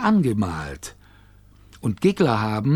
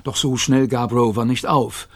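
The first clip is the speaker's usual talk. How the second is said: ordinary delivery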